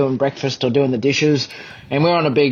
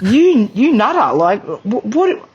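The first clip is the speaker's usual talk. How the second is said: stunned disbelief; 7 semitones higher, slower than usual. Real, not acted. Stunned by the huge catch.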